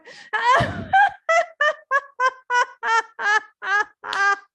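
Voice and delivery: loud, high, sustained belly laughing. Shown as a demonstration. Teacher leading a laughter yoga belly laugh, full out. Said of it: "Oh, one more time".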